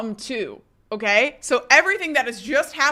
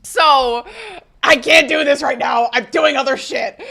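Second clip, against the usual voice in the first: mock boyfriend; 4 semitones higher, 7 dB louder than usual. Voicing boyfriend brushing her off.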